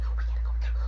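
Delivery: hushed whisper. Retold or imitated. Brother whispering while hiding, hushed.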